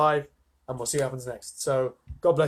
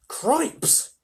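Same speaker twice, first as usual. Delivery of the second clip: startled exclamation; 11 semitones higher than usual. Acted. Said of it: "said Mister Bucket".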